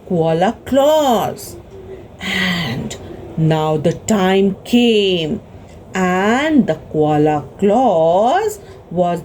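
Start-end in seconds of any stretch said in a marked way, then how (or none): none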